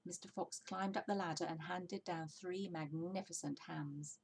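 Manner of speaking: plain reading voice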